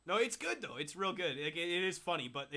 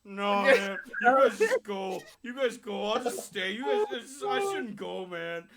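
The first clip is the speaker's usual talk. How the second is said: higher-pitched, hammed-up dejection; mock sad drunk; 5 semitones higher, 6 dB louder, slower than usual. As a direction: play a drunk friend bowing out. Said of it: "He's so sad".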